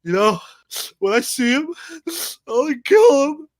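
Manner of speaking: hammed-up weepy promise of revenge; a put-on sob rising to a wail; mock tearful vow. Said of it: "And he's like".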